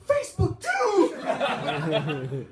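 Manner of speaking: loud, high, drawn-out hammed-up cry; mock horrified wail. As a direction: stretch it out in fake despair, laughing. Diners horrified.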